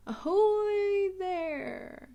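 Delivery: high, loud, slow sailor's call; Grandpa Pig's hail. Acted. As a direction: call it out across the water. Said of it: "Grandpa Pig says".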